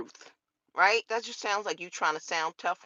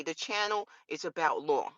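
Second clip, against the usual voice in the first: ordinary delivery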